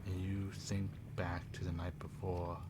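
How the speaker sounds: quiet, low game-master narration; eerie scene setting. Acted. Game master describing watching eyes and the dead, eerie narration. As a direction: set an ominous scene, softening toward the end.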